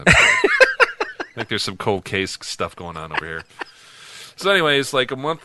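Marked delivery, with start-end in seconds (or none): 0.1-1.2 laughing. Real, not acted. Bursts out laughing at joke.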